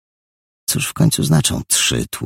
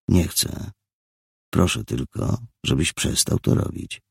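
soft, half-whispered; quiet restraint; 5 dB quieter than usual. Acted. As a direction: hold the anger back. Richard restraining himself.

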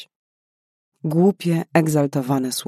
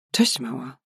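calm male figure; 4 dB quieter than usual. A narrator's reading. Hidden figure greets startled woman.

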